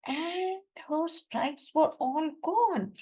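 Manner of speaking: sad storytelling. A narrator's reading. Storytelling sad moment, slowed.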